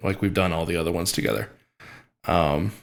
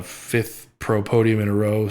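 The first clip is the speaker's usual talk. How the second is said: ordinary talk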